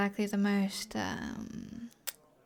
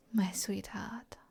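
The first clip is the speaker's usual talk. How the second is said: slow, soft, intimate whisper; flirty whisper; 5 dB quieter, slower than usual. Acted. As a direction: purr the pet name slowly. Flirting with her drinking companion.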